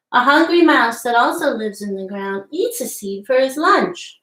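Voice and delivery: storybook read-aloud voice, lively and clear; reading to children. Acted. Teacher reading The Tiny Seed aloud to children, lively storybook voice. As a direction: read the tale to little listeners. Reads picture book aloud.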